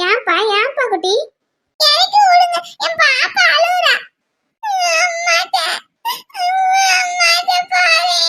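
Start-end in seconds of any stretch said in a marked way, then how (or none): 1.8-8.2 high, drawn-out wail; slow tearful pleading, stretching the words; crying child. Acted. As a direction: cry out for mother. Crying children want down and mother.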